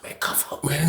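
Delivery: whispered retold plea; hushed urging. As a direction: urge a cellmate under your breath. Quietly urging cellmate to comply.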